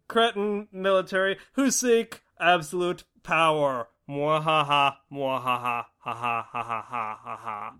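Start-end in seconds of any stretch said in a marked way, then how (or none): 4.1-7.7 evil villain laugh, dropping low; sneering panto villain. Acted. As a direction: cackle like a pantomime baddie. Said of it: "Brava, brava".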